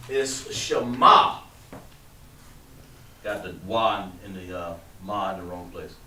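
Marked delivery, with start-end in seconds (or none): 0.1-1.4 Shema drawn out slowly, sha-MA; corrected pronunciation. Shown as a demonstration. Teacher correcting his own mistake, saying Shema slowly. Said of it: "I spelled it wrong".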